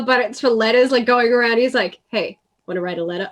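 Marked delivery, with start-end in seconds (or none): 2.1-3.3 low, hushed, quick furtive offer; shady dealer. Retold or imitated. Jesper peddling letters like a crack dealer, furtively hushed. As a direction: imitate a dealer making a secretive pitch. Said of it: "acting like a crack dealer".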